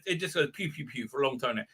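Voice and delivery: normal talking voice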